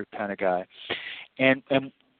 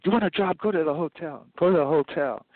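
imitating Thomas; 6 semitones higher than usual. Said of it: "this guy named Thomas said".

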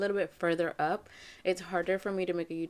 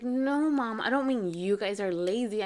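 insistent backtracking, pitch raised; retold protest; 2 semitones higher, 4 dB louder than usual. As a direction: imitate a kid defending herself. Daughter protests in retold quarrel.

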